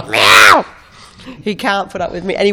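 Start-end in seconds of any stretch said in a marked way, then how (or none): 0.1-0.6 loud, low imitated yowl; grumpy cat meow. Grumpy old cat complaining to its owner, loud meow. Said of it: "he's just like".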